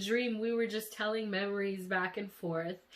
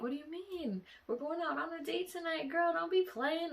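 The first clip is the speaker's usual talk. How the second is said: playful higher-pitched imitation of him; imitated boyfriend; 10 semitones higher than usual. Boyfriend insisting on their date, imitated and incredulous. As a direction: brush off the question in a high, teasing retold voice. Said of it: "And he was like".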